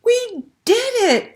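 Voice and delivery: a mermaid cheering with her friend. Acted. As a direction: shout it out in triumph. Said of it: "Una cheered".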